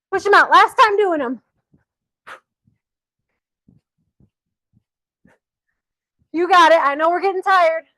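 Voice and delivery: coach's shout. Real, not acted. Coach cheering on tired jumpers, shouting. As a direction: shout encouragement at a tiring class. Coach urging through squat jumps.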